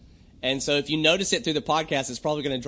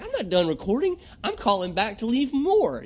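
much higher, protesting the cutoff; indignant; 7 semitones higher than usual. Retold or imitated. Protesting to the voicemail system, much higher and indignant. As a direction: insist you're not finished, offended. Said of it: "And I'm like".

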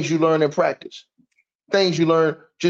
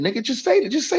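mock pleading; 10 semitones higher than usual. Real, not acted. Taunting opponent to concede.

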